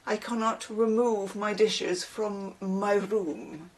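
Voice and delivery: a hint of a prim Victorian son, slow and formal; mock Victorian speak. Imitating an online son scolding his mother, mildly formal. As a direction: lightly put on old-fashioned formality. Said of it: "mostly he argues in Victorian speak".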